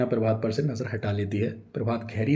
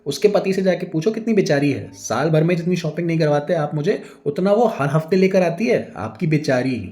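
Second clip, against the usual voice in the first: loud, higher jealous tirade; angry scolding; 7 semitones higher, 7 dB louder than usual. A narrator's reading. Jealous wife yelling at her husband, loud and angry. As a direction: tear into him with jealous fury.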